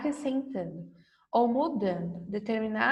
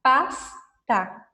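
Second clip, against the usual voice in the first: slow and clear; 3 dB louder, slower than usual. Shown as a demonstration. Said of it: "Se eu colocar S".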